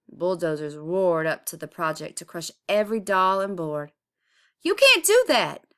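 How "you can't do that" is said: high, loud protest; yelled. A narrator's reading. Neighbors yell at the city crew, high and loud. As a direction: shout at the bulldozers. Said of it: "the neighbors yelled".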